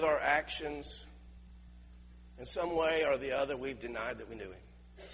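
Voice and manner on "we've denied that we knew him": ordinary talk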